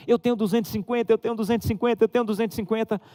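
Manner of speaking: high-pitched, fast, gleeful chanting; a boy crowing over his savings; excited child shouting. Retold or imitated. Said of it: "começou a gritar dentro de casa". (menino gritando de alegria, agudo e rápido)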